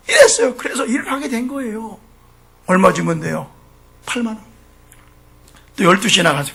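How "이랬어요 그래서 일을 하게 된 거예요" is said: normal talking voice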